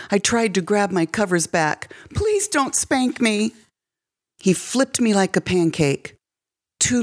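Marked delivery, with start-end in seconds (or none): 2.2-3.5 higher, slow frightened plea; a little girl afraid of the belt; begging child. A narrator's reading. Girl begging stepfather not to spank, high frightened voice.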